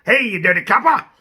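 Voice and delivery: lightly voiced [name] gangster; a hint of a tough 1940s crooner; old-time gangster. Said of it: "be like a [name]".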